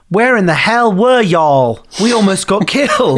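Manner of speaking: put-on Southern accent. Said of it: "Southern twang out of nowhere".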